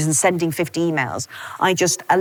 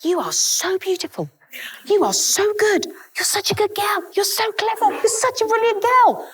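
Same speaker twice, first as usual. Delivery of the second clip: put-on much higher, cooing baby-talk praise, partly whispered; puppy baby talk. Crouched next to her new puppy, high cooing praise. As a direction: gush over a puppy in a tiny high voice. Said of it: "crouching next to this puppy going".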